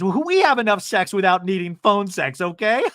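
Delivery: mock brag breaking into cackles; imitating her laugh. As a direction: end the line in her high giggling. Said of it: "That's her laugh".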